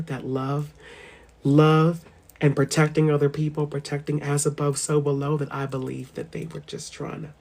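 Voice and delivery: low, soft, earnest musing; sincere and hushed. Real, not acted. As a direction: confess your belief gently. Sincere, quiet belief.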